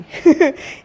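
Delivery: a quick amused laugh; brief chuckle at her own joke; short laugh. Real, not acted. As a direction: laugh it off lightly.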